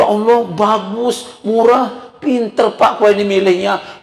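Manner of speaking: slow, high-pitched savoring of each word; imitated impressed wife, drawn out and high; gushing admiration. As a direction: praise the bargain word by word. Wife praises the cheap purchase.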